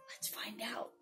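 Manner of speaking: hushed whisper. Real, not acted. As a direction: whisper it secretly. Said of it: "reader whispers".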